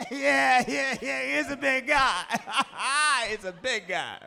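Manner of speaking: high, loud, laughing boast; laughing gloat. Acted. Hidden boyfriend flattered by mom's remark, laughing gloat. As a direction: crow it proudly through big laughs.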